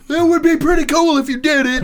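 high, loud comic holler; yell. Acted. Player botching a plea to a raging friend, high yell. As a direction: holler the whole line at top pitch. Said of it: "and I yell".

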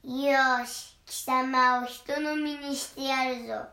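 loud, slow menacing troll. Acted. Troll threatens the second goat, slow and loud. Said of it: "とトロルが言いました".

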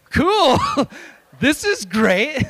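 high, loud panicky laughing reply; nervous fake cheer. Pastor answers passenger talking of mass murder, nervous fake cheer. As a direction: pretend to be delighted while terrified.

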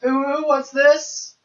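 high, slow cutesy coo. Pretend. Cutesy meme voice.

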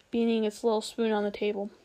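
usual speaking voice

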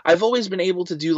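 ordinary delivery

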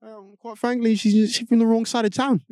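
British accent mocking the cops. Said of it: "The British accent".

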